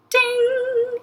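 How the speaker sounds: high, ringing sung ding; imitated elevator bell held on one high note; bright bell-like "ding", pitched high.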